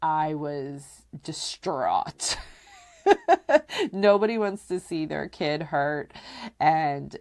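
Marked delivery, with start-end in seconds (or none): 3.0-3.9 a short, high burst of laughter; relieved laugh. Real, not acted. Vlogger laughing after the scary story, high and short. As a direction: laugh off the tension.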